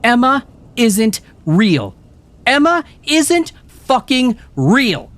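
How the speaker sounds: very high, loud and slow furious yell; girl insisting her friend isn't real, furious yell. A narrator's reading. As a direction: hammer each word in rage. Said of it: "manic and furious".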